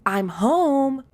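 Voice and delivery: loud, high mock shout of a dad coming home; hammed-up dad arriving home. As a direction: bellow it like the tired breadwinner. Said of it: "I shouted".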